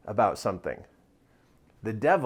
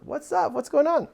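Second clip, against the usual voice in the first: dad responding; 12 semitones higher than usual. Retold or imitated. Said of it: "And I'm like".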